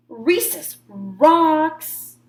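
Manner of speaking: loud, slow bellow. A narrator's reading. Shark bellowing his love of recess at full volume. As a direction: drag it out like a roar. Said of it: "bellowed Clark the shark".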